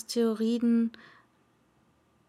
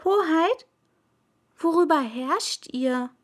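put-on higher boyish voice, a little louder; a touch of a courteous little prince; polite prince; 6 semitones higher, 4 dB louder than usual. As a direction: address his highness politely. Prince asks.

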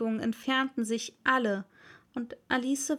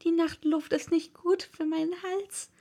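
put-on old magpie; 7 semitones higher than usual. A narrator's reading. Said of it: "eine alte Elster ... bemerkte".

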